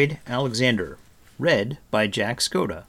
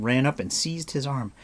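ordinary delivery, nothing marked

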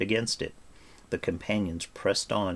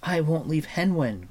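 higher, moderately raised protest; protesting cry; 8 semitones higher than usual. Acted. Taran refuses to abandon his pig, crying out. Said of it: "cried Taran".

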